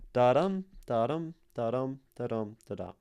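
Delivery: "da-dum" chanted with the weight on "dum"; "da-dum" pulse starting strong and fading; iambic da-dum rhythm. Shown as a demonstration. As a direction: beat out "Da-dum, da-dum" short then long. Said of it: "It goes short, long".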